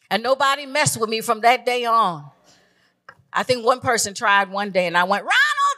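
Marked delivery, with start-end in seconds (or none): 5.2-5.8 calling out. Retold or imitated. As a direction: yell for the big cousin. Calling protector to scare bully.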